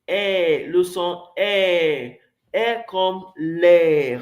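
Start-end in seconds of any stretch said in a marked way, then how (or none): 2.5-4.2 "air comme l'air" stretched slowly, open vowel held; careful slow teacher's demo of "air comme l'air"; slow model pronunciation. Shown as a demonstration. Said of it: "Répétez après moi".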